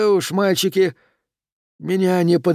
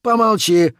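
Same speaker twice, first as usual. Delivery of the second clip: sharp, louder, higher shush; cutting old man's hush; 7 semitones higher, 4 dB louder, slower than usual. A narrator's reading. Old uncle silences his nephew, sharp and loud. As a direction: cut the boy off short. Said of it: "оборвал его старик".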